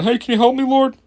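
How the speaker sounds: high, whiny mock prayer for help; mock plea to God. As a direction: beg heaven like a caught creep. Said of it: "now you're trying".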